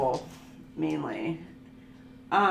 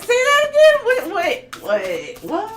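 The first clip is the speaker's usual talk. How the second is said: mock disbelief; 13 semitones higher, 11 dB louder than usual. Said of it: "be like".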